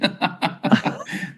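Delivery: short breathy laugh; amused unvoiced chuckle; real laugh. Real, not acted. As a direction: laugh at the funny coincidence. Laughs at chat comment.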